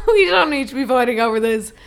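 laughing through the words. Real, not acted. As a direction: giggle while pleading.

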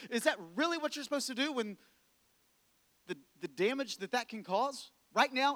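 ordinary talk, nothing marked

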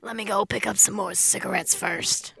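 croaky chain-smoker voice; old smoker voice. Pretend. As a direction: rasp it like a heavy smoker. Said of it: "That's the voice".

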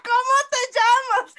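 high, loud shouted question; retold yelling. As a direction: imitate someone screaming at you. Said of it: "el mae nada más me gritaba".

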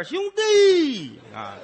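slow, high drawn-out wail; wailing old man. Acted. Old man in the well calling his helper, long high wail. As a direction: stretch the word out like a crying beggar. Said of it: "非得叫号".

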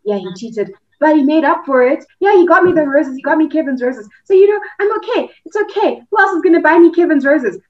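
mock the girl who keeps forgiving him, rising to a high squeak; naive girlfriend excusing him. Mocking a girlfriend who defends her cheating boyfriend, rising high and loud. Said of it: "that's how you get to a point of saying".

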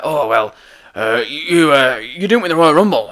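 half-managed boss impression, stumbling into the line; Vince McMahon. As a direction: have a rough go at the company boss, halting on "uh, you". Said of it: "I cannot do a Vince McMahon impression".

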